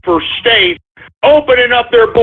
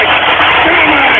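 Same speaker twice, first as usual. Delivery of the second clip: drawn-out, slower demand for the mic; slowed, insistent repeat of the demand; slower angry demand; 4 semitones higher, slower than usual. Acted. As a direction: stretch it out and insist.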